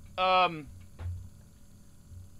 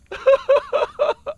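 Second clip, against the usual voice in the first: hysterical laugh; 8 dB louder than usual. Acted. Breakdown after rejection.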